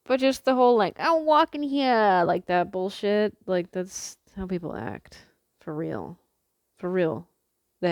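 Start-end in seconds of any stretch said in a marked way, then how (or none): 1.0-2.2 loud, high, drawn-out New York bark; mock East Coast tough-talk; hammed-up New Yorker holler, stretched out; East Coast accent. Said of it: "Love East Coast accents".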